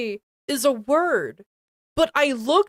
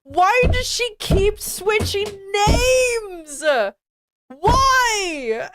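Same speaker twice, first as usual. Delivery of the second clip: high, slow, whiny fake sob; comic crying lament; mock crying wail; 8 semitones higher, slower than usual. Real, not acted. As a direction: wail in exasperation. Exasperated by reading mistakes.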